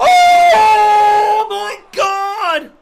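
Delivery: screaming ringside play-by-play, high; wrestling commentator. Real, not acted. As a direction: call the near falls at full hype. Live play-by-play of match.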